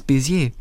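"Bézier" as bay-zee-AY, French-style; French pronunciation. Shown as a demonstration. Reader giving the family's newly adopted surname, French pronunciation. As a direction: give "Bézier" its French "ay" ending. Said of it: "hugenottischen französischen Ursprungs".

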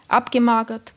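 ordinary delivery, nothing marked

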